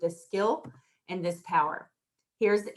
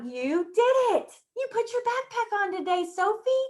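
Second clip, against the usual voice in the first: put-on high, lilting praise for a child; teacher praising Sophie for her backpack; enthusiastic praise; 11 semitones higher than usual. Shown as a demonstration. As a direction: lift every word up in delight.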